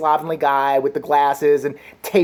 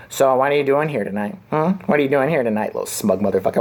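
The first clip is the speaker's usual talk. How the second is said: smug host impression; 5 semitones lower than usual. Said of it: "then they usually go, my favorite".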